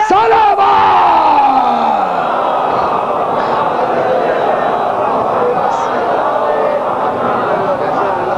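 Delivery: long, drawn-out rallying shout; calling for salawat. Real, not acted. Calls congregation to recite salawat. (لمبی بلند پکار)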